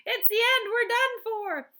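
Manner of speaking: high, loud panicked cry; shouted. A narrator's reading. Grandpa Joe crying out as the roof crashes. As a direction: cry out for help. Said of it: "Grandpa Joe shouted".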